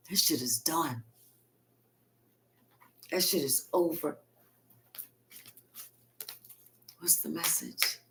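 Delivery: hushed, breathy whisper of finality; whispered aside. Real, not acted. Reader whispering to viewers that it is over. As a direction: drop to a secret whisper.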